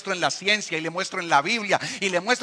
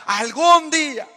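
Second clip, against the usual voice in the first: slow dad warning; 9 semitones higher, 6 dB louder, slower than usual. Retold or imitated. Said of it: "El discurso de mi papá era".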